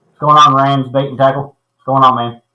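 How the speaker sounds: ordinary talk, nothing marked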